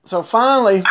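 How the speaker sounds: normal talking voice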